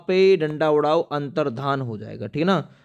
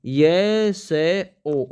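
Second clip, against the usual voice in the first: letters drawn out slowly; slow spelling out; 3 semitones higher, much slower than usual. Shown as a demonstration. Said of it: "यशो लाभ". (धीरे-धीरे वर्तनी बताते हुए)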